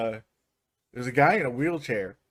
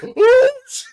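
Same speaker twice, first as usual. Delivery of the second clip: laughing; 13 dB louder than usual. Retold or imitated. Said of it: "I was just like".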